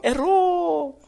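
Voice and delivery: loud high Errou! shout; Faustão impression. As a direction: belt it like a TV host. Faustão meme catchphrase.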